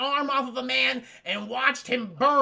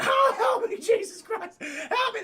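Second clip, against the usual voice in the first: high mock-panicked yelp; panicked cry for help; 14 semitones higher, 5 dB louder, slower than usual. Luke being dragged underwater, hammed-up cry for help. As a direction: wail for help, pitched way up. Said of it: "Luke's like".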